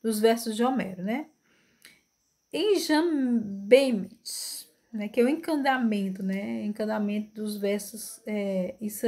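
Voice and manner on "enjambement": stretched out syllable by syllable; slow, deliberate term. Shown as a demonstration. Teacher announcing a French term, each syllable drawn out. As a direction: sound the foreign word out very slowly.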